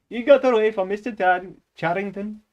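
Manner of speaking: put-on high, loud Indian-accented reading. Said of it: "you picked Indian for that reason".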